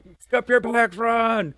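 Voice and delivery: high, loud barked order; drill sergeant yell. Retold or imitated. Drill sergeant yelling at a lollygagging recruit, loud bark.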